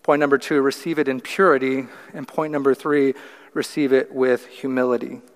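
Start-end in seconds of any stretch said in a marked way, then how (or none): none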